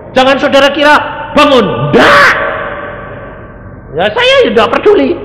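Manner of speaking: high, loud shout of denial; preaching shout. Real, not acted. Preacher shouting at the congregation, loud denial. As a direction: shout it down to the crowd.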